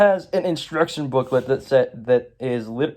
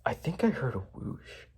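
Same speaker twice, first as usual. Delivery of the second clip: hushed wonder; 3 semitones higher, 13 dB quieter than usual. Retold or imitated. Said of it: "he's like".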